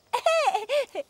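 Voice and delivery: bright-pitched little laugh; giggle. Acted. Girl amused on meeting goat. (ψηλό, σύντομο γελάκι)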